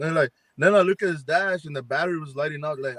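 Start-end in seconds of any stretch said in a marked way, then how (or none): none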